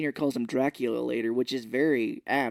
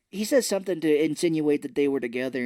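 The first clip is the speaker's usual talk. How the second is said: ordinary talk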